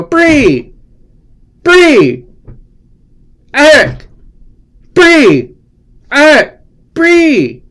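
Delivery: high, loud shrieked name calls; woodchuck video scream. Pretend. Calling out to Bree like the screaming woodchuck video, high and loud. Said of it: "It's like that woodchuck video".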